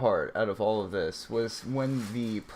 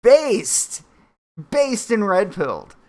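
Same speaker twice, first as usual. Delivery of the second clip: excited; 8 semitones higher, 7 dB louder, slower than usual. Real, not acted. Cheering at clip.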